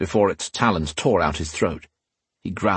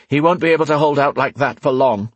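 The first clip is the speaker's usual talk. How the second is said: raised, urgent-sounding voice; higher and tense, pressing the point; 5 semitones higher, 4 dB louder than usual. A narrator's reading. Rion watching Alesh fight on, tense. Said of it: "Rian observed in a tense voice".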